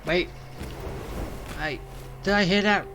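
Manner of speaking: ordinary delivery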